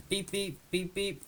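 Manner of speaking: little high beep noises; imitated beeps. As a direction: mimic a cockpit alarm in short beeps.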